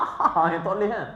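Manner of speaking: mock guffaw shown as forbidden; demo loud guffaw. Shown as a demonstration. Preacher showing the laugh Allah dislikes, mock guffaw. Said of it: "kita keluarkan suara".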